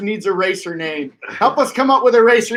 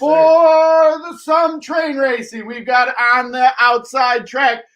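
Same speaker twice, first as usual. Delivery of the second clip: put-on overbright kids party host; 7 semitones higher, 3 dB louder than usual. Announcing the train race.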